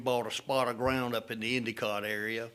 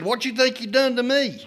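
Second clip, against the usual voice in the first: much higher, loud, incredulous squawk; indignant comeback; 12 semitones higher, 9 dB louder than usual. Retold or imitated. Paul firing back at the driver, a loud incredulous comeback. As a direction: throw it back at the driver, amazed. Paul's retort after near crash.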